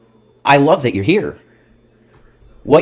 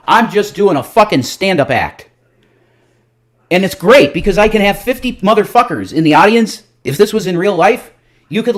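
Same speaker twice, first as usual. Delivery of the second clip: higher, louder heated outburst at the chat; loud defiant rant; 9 semitones higher, 7 dB louder than usual. Real, not acted. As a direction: push it up and out, defiant.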